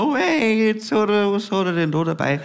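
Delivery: a hint of a surprised old granny; granny peeking at what he brought home; Grandma voice. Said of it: "gell, meine Oma".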